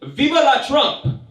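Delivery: put-on high, slow, loud old Cuban lady cheer; imitating grandma. Cuban grandmother signing off a phone call, high cheer. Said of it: "she ends every conversation by saying".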